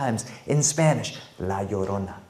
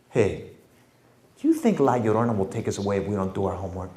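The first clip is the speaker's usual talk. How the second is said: put-on conspiratorial kid; 6 semitones lower, 5 dB quieter, faster than usual. Kid whispering to brother.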